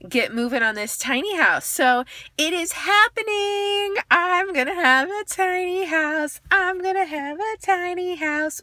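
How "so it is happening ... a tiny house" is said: excited. Real, not acted. Thrilled about tiny house news.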